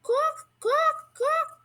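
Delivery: high rising quacks like a rain bird; bird quacking. A narrator's reading. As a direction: squawk it out like the bird, high and sharp. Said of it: "ear-bursting rain cry".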